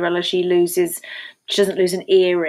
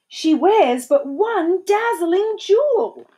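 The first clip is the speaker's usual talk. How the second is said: high, slow proclamation; drawn-out, high-pitched amazement; amazed cry; 11 semitones higher, 4 dB louder, slower than usual. A narrator's reading. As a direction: cry out a wondrous discovery. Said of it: "Cried the Duke".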